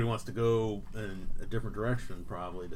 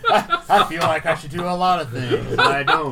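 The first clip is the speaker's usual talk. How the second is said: laughing; 9 semitones higher, 13 dB louder, slower than usual. Real, not acted. Laughing reply to teasing.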